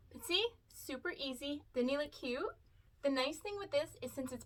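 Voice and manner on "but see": normal talking voice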